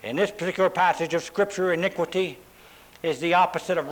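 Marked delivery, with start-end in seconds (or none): none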